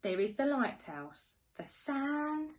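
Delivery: sing-song storybook rhyme; reading to children. Acted. Teacher reading to little ones, sing-song and playful. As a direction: read it like a bedtime picture book. Reads rhyming picture book.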